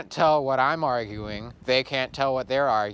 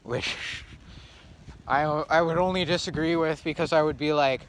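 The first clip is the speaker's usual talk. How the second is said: usual speaking voice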